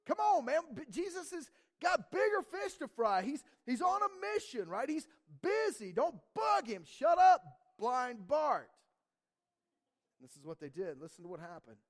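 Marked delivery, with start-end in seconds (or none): 0.0-8.7 loud, high scolding to shut up; telling off. Acted. Crowd silencing a blind beggar, loudly scolding. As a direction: hush a nuisance with loud, impatient outbursts. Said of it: "many people told him to keep quiet".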